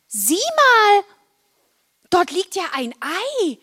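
high, drawn-out call of discovery. A narrator's reading. Marla calls to Wilma in the park, calling out high. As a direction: call it out high, as if spotting something. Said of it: "als Marla rief".

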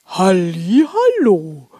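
booming, drawn-out greeting; jolly Santa. Acted. Santa greets elves entering his hall, jolly.

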